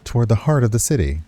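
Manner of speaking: steady, even storytelling read; story narration. A narrator's reading. Streamer reading a game's narration aloud, measured storytelling. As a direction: narrate the scene to the viewers.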